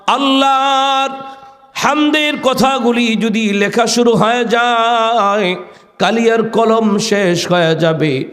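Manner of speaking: high sermon chant on a held note; preacher's intoned high call; impassioned preaching. Acted. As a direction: proclaim the lesson high and ringing.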